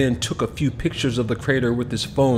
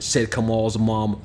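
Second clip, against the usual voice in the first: usual speaking voice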